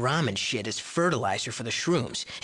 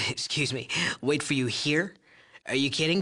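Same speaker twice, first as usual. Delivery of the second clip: ordinary talk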